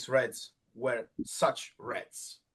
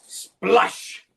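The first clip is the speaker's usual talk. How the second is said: splash; 5 semitones higher, 5 dB louder than usual. Acted. Paint splash sound effect.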